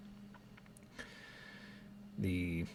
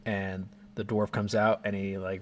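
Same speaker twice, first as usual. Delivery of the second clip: normal talking voice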